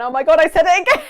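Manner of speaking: embarrassed surprise. Real, not acted. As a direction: blurt it out, caught in the act. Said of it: "caught herself saying good".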